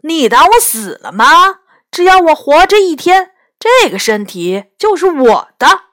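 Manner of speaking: loud, high, argumentative retort; acted indignant protest, pushed loud; indignant objection. A narrator's reading. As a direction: fire back loudly at a rival. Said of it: "另一个声音立刻反对说".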